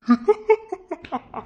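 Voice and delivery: high burst of laughter; mocking laugh. Laughing at friend's love advice.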